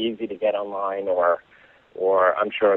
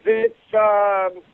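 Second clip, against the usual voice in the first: put-on higher old-man voice groping for a word; old man can't recall the flower's name, higher hesitant voice; forgetful old man; 7 semitones higher than usual. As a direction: fumble for the word like a muddled old guy. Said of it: "And he says".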